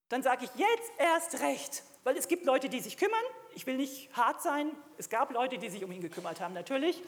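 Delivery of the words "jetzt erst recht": slow, high, emphatic declaration; defiant resolve. Retold or imitated. Preacher retelling his answer to trouble, defiant resolve. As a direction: stretch each word out as a defiant vow.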